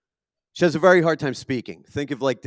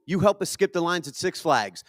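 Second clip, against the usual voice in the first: ordinary talk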